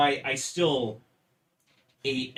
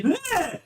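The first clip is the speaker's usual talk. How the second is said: disgusted bleh; 5 dB louder than usual. Retold or imitated. Retelling reaction to mayo.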